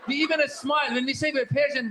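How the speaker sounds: ordinary delivery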